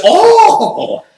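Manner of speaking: very high, loud squeal of shock; a falsetto yelp of outrage. Retold or imitated. Ellis hearing the insult, a very high, loud shriek.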